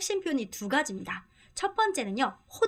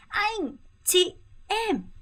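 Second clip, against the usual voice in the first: wrong high call; 8 semitones higher, much slower than usual. Shown as a demonstration. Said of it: "전혀 안 됩니다".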